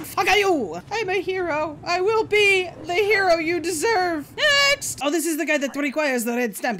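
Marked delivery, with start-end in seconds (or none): 0.0-4.3 high, wailing hammed-up hero; mock heroic sob. Player hamming up a hero after detaining. 4.4-5.0 high loud call of next!. Acted. Inspector calling the next traveler forward.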